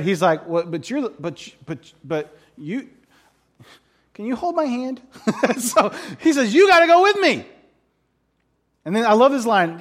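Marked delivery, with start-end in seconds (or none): 0.5-5.0 hesitant, stammering imitation of fear; mock scared. Preacher playing a general picked by Deborah, comically timid. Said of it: "is so scared". 6.5-7.5 high, loud mock pleading; hammed-up scared demand. Preacher playing the general begging Deborah along, mock desperate. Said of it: "is so scared".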